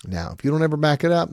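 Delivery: ordinary talk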